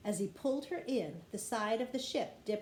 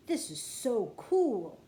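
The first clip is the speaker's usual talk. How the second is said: called out; 2 semitones higher, 6 dB louder, slower than usual. A narrator's reading. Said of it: "he called".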